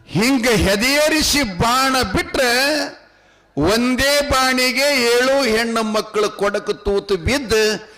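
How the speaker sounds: high, ringing folk-tale recitation; high-pitched, sustained narrator's chant; declamatory storytelling. A narrator's reading. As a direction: declaim it high, stretching the phrase endings.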